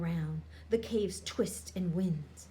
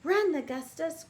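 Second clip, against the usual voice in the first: higher urging shout; urgent cry; 6 semitones higher, 3 dB louder than usual. A narrator's reading. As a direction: tell the prince to flee. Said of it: "cries Lady Nell".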